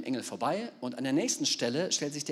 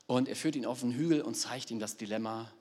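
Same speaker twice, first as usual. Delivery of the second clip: ordinary talk, nothing marked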